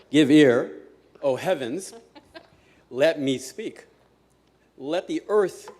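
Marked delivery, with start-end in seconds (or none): 0.1-1.9 "Give ear" proclaimed loudly; loud proclamation. Shown as a demonstration. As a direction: boom "Give ear" to the crowd.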